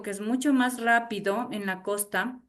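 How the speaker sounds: usual speaking voice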